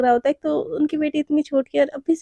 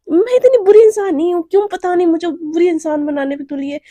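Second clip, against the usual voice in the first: angry outburst; 3 semitones higher, 5 dB louder than usual. Acted. Naina defends herself, loud and fed up. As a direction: push back in frustration. Naina fuming inner monologue.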